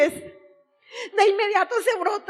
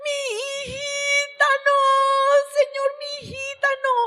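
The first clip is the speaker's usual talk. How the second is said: crying father; 8 semitones higher, 3 dB louder than usual. Retold or imitated. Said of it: "empieza a llorar y grita".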